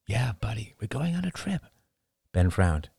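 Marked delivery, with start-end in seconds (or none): 0.1-1.6 softer, gently encouraging; father softly promising his son a trip; soft reassurance. Acted. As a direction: soothe a suspicious child.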